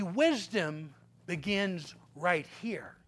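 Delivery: slow, soft and low; preacher making a point hushed; soft emphasis. Shown as a demonstration. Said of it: "repeated quietly".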